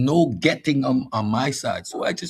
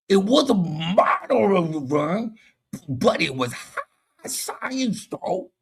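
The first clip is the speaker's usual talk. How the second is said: slow, high-pitched mock voice; mocking a fool; 6 semitones higher, slower than usual. Debater mocking an opponent's argument, slow high caricature. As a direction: drag it out in a hammed-up caricature. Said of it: "you sound like an illiterate fool".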